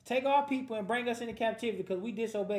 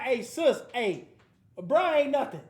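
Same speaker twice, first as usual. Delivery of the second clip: blunt warning; 3 semitones higher, 6 dB louder, slower than usual. Retold or imitated. Friend warning sis that the guy ain't nothing, loud and dismissive. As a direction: brush him off out loud. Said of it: "you be like".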